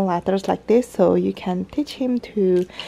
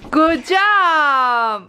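high, louder praise for a little one; 8 semitones higher, 4 dB louder than usual. Real, not acted. Mom praising her toddler after the crawl, high loud cheer.

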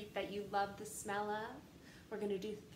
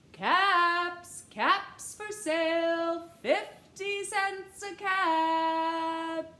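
loud, slow sing-song street call; 6 semitones higher, 11 dB louder, much slower than usual. Acted. Peddler walking back to town, calling caps for sale loudly. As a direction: call out your wares down the street.

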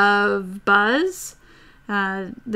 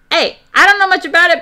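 excited; 8 semitones higher, 7 dB louder, faster than usual. Real, not acted. Excited outburst.

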